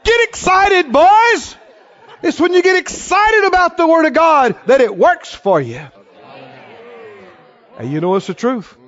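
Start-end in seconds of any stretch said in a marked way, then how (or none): 0.0-5.8 high, loud, fired-up exhortation; Brother Hagin's rousing shout. Retold or imitated. Teacher waking up his drowsy front row, rousing shout. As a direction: rouse them with a high preacher's yell. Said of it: "He said".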